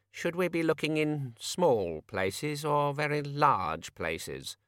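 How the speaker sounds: slow, fading into confusion; slow trailing off. A narrator's reading. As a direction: let the thought drift off. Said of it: "train of thought entered a tunnel".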